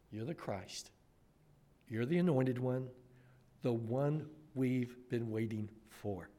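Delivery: almost breathe it in awe; hushed awe of a disciple; hushed confession. Acted. Same confession said again hushed.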